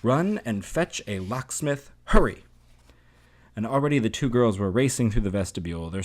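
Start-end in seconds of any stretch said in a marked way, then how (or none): 0.0-1.9 slow, raised-pitch order, only mildly shouted. A narrator's reading. Father sends for a locksmith, mild stretched-out shout. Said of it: "the father shouted into the kitchen". 2.0-2.4 loud, high, urgent shout; shouted order. A narrator's reading. Father hurries the maid along, loud shout. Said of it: "the father shouted into the kitchen".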